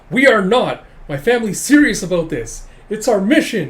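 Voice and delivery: high, loud indignant retort; hammed-up huffy insistence; ticked-off. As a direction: protest like an offended kid. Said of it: "getting ticked and going".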